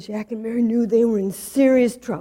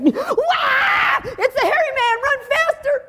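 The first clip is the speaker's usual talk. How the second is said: put-on much higher, panicked shout of terrified children; frightened scream; 12 semitones higher than usual. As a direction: yell in fright as if something is chasing you. Children spot the hairy man.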